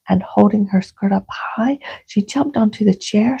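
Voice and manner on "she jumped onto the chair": plain reading voice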